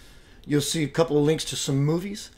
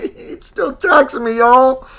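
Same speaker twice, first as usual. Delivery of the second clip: high, loud, slow mock complaint; hammed-up high whine; 9 semitones higher, 8 dB louder, slower than usual. Shown as a demonstration. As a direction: wail it in an exaggerated voice. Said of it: "Listen, listen to me".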